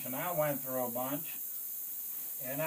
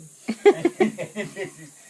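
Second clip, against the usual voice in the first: big burst of laughter, much louder; 6 semitones higher, 13 dB louder than usual. Real, not acted. Veteran cracking up remembering his crew. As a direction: laugh out loud, high and hearty.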